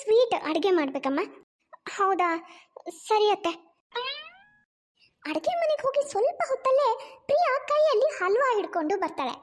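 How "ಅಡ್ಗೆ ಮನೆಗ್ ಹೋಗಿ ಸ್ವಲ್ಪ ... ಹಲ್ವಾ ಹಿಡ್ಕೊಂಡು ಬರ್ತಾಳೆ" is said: higher-pitched, lilting storyteller voice; story narration. A narrator's reading. Storyteller moving on to the wedding, lilting narration. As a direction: narrate with big rises and falls.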